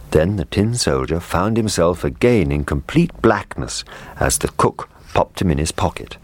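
usual speaking voice

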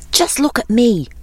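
high-pitched show-off; boasting. A narrator's reading. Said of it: "he was boasting".

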